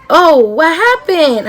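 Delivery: a nurse startled at the mess; surprised nurse. Retold or imitated. Nurse finds her in vomit.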